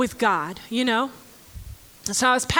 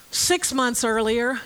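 ordinary talk

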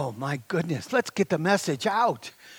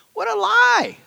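much higher and louder, scornful; indignant outburst; 6 dB louder than usual. Real, not acted. Preacher rejecting old discouragement, loud and high. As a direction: cry it out against the lie.

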